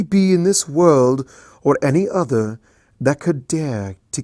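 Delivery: grand royal proclamation; imperious princess. Acted. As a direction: declare her power to the world. Celestia proclaiming power.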